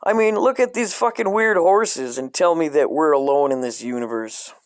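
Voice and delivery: ordinary talk, nothing marked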